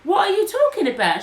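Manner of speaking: high, loud, indignant question; retold bafflement. Accused woman answering the stranger, retold indignant disbelief.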